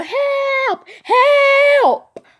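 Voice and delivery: high, drawn-out retold yell; yelling for help. Runner chased by a dog to neighbor's house, yelling. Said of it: "I yell".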